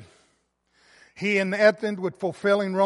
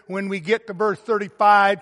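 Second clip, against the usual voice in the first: normal talking voice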